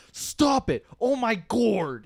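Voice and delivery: shrill mock outburst; exasperated yell. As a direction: yell stop it at a pestering dad. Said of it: "I was like".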